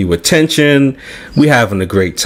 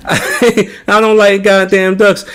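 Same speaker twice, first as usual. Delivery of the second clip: higher, slow, laughing through the words; laughing mock complaint; 8 semitones higher, slower than usual. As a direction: laugh as you grumble about ducks. Laughing mock quote of himself.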